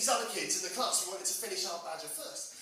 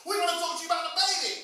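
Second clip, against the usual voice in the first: louder, higher, irritated telling-off; mock exasperated outburst; exasperated snap; 8 semitones higher, 8 dB louder than usual. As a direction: snap at him to drop the handbag topic. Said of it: "They'd be like".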